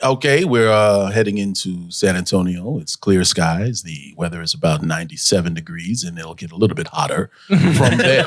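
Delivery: slow, low airline captain voice; calm captain over the intercom; pilot announcement. Retold or imitated. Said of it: "the pilot was like".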